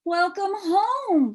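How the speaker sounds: high, slow, loving greeting; warm welcome. Acted. Mother greeting daughter home, slow and loving. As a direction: welcome her home tenderly. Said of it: "said her mother".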